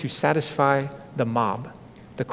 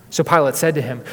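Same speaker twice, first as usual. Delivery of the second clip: ordinary talk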